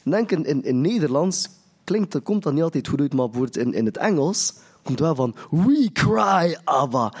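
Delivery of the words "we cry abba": raised, drawn-out call; mild crying out. Acted. Preacher quoting the English verse, mildly crying out. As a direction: call out to the Father, half-projected. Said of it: "cry is zo van, niet enkel roepen, maar schreeuwen".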